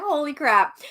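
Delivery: ordinary talk, nothing marked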